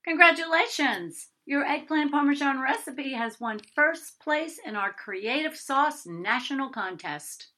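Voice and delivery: bright, loud, high announcement; cheerful caller. A narrator's reading. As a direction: gush the good news like a cheery promoter. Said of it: "The cheerful voice continued to chatter".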